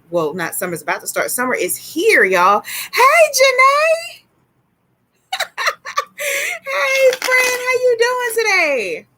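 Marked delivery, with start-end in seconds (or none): 2.9-9.1 very high, drawn-out hello with a laugh; sing-song, high-pitched excited hello; squealed greeting. Real, not acted. Greeting a viewer who joins.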